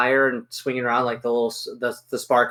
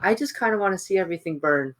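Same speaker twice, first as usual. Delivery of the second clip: higher, put-on wistful voice; fire-loving character; 3 semitones higher than usual. Fire lover telling the gnoll what he wants, lightly put-on longing. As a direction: confess your love of burning, sliding downward. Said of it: "he's just kinda gonna look over at him".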